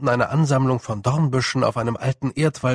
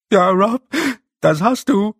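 high, loud, fretful little voice; squeaky wail; 8 semitones higher, 7 dB louder than usual. Acted. A small blue man wails in fear at the snow. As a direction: wail it like a panicking small creature. Dover answers.

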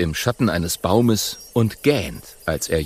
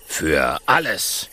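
slow, low lion voice; lordly lion; 4 semitones lower, slower than usual. Acted. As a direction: answer grandly as the lion. Lion answers.

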